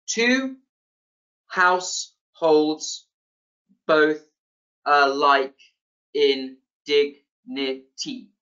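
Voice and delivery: slow, syllable by syllable with gaps; counting out syllables. Shown as a demonstration. Lecturer showing students ten syllables in a line, counted out syllable by syllable. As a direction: break TWO HOUSEHOLDS BOTH ALIKE IN DIGNITY into separate, evenly spaced syllables. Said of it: "you can count this yourself".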